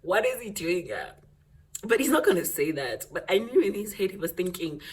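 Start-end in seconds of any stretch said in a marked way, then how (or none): 0.0-1.1 high, slow, laughing words; laughing while talking. Real, not acted. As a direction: talk through your laughter. Laughing through telling.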